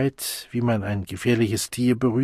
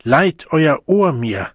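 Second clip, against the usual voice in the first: slow, loud, raised appeal; deeply earnest call; 5 semitones higher, 7 dB louder, slower than usual. A narrator's reading. The Savage asks the Deltas to listen, earnest and loud. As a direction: declaim it solemnly to a crowd. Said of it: "rief der Wilde in tiefem Ernst".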